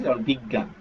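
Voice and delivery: ordinary talk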